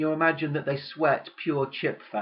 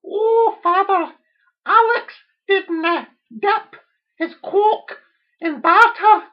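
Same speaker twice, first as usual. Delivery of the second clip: put-on very high, loud crying Scots girl; hammed-up tearful Scottish plea; mock Scottish wail; 13 dB louder, slower than usual. Daughter wails to her father in mock Scottish. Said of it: "this is how the Scottish speak".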